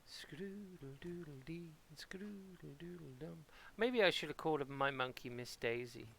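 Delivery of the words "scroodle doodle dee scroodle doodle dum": soft unvoiced sing-song whisper; whispered rhyme. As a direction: breathe the nonsense rhyme quietly.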